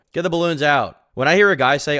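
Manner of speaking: normal talking voice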